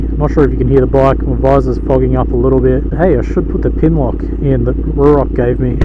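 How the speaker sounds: muffled voice inside a motorcycle helmet; talking into a helmet mic while riding. Real, not acted. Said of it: "I've got the visor closed on the Ruroc Atlas".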